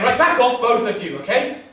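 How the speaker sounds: loud, high order; loud and exasperated; firm intervention. Acted. Breaking up the quarrel.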